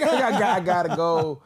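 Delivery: loud, slow, laughing mock plea; mock-desperate, cracking up; laughing plea to leave. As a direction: drag it out through laughter. Retold escape from a long talker.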